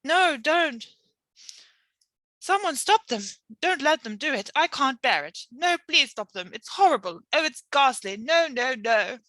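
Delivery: high, pleading nightmare voice; frightened pleading from a bad dream; distressed sleep talk. A narrator's reading. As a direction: beg in your sleep for it to stop.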